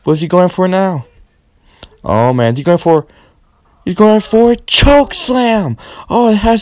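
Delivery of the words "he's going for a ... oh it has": excited call. Acted. Building to the big move.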